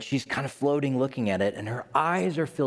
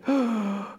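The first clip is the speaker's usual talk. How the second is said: awed gasp; 9 semitones higher than usual. Retold or imitated. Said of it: "I was like".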